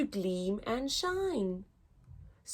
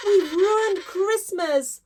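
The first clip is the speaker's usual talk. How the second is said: higher, louder hammed-up despair; crying out in dismay; 8 semitones higher, 5 dB louder than usual. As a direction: wail it to the group, pitched up. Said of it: "cried out Paul".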